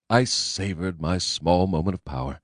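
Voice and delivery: ordinary delivery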